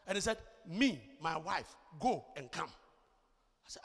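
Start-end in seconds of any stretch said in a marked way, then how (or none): 0.7-2.7 slow, word-by-word simplified English; mock pidgin in separate chunks; imitated broken English. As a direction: spell it out for a non-English speaker. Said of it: "what language is this guy speaking".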